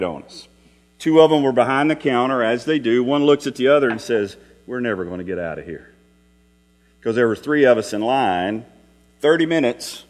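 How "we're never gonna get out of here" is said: low, fast muttered complaint; a worker's quiet, hurried grumble; grumbling aside. Retold or imitated. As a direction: mutter it under your breath to a coworker.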